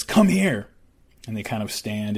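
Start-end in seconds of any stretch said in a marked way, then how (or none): 0.0-0.7 sharp command. Retold or imitated. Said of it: "And so Callie says".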